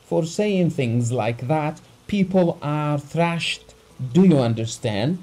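high, loud menacing warning; angry threat. A narrator's reading. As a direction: threaten him hotly. Abogin threatening the doctor.

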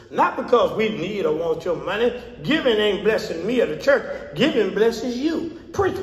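ordinary talk

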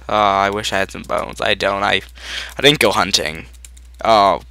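ordinary talk

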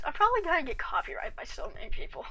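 quick whispered aside; fast whispered aside. Real, not acted. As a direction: hush it, as if not to get caught. Aside about copyright, whispered.

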